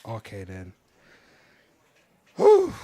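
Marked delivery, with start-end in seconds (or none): 2.4-2.7 loud, high-pitched "whew"; sharp rising exhale; high whoop of breath. Real, not acted. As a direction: blow out a high, loud "whoo". Bracing before playing the video.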